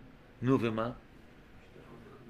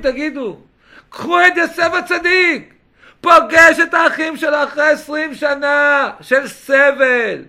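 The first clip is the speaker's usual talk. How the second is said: loud, incredulous exhortation; impassioned preaching; 9 semitones higher, 9 dB louder than usual. Real, not acted. Preacher rebuking people who stop talking for years, loud and impassioned. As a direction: hammer the point home, raised pitch.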